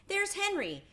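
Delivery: put-on delighted girl spotting a friend; excited cry. A narrator's reading. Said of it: "cried Fern".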